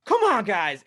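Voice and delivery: high, loud retold yell at the team; upset yelling. Upset fan yelling at his team on TV, re-enacted. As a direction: re-enact shouting at the TV. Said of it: "I'm yelling at the T V. I'm really upset".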